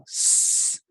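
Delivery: "s" as a long unvoiced hiss; isolated S sound. Shown as a demonstration. Teacher showing pupil the closed-mouth sound, hissed S. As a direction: hold a steady hissed "s". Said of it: "it's an S sound".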